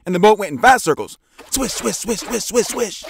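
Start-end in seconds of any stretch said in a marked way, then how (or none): 1.5-3.0 quick high swishes. A narrator's reading. Fast circling sound effect.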